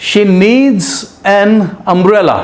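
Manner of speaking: very slow, word by word; slow dictation read. Shown as a demonstration. Teacher reading the example sentence slowly to students. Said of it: "एक और sentence देखते हैं".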